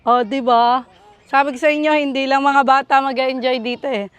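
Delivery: usual speaking voice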